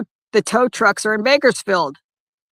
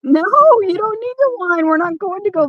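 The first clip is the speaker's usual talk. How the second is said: much higher sing-song scolding to the dog; high pet talk; 10 semitones higher, 4 dB louder than usual. Real, not acted. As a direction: lift the voice high, coaxing the dog.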